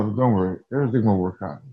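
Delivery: ordinary talk, nothing marked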